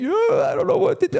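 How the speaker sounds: very high, helpless squeaky whine; panicked whine. Retold or imitated. Informant whining to the agent, very high and helpless. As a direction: squeal it like a lost frightened man. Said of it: "informant squeals again".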